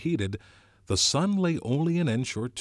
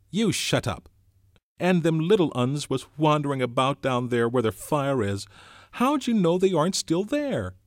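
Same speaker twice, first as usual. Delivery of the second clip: put-on loud, higher boy's retort; angry; 8 semitones higher, 7 dB louder than usual. A narrator's reading. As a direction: bite back at him angrily. Jack turning on Piggy.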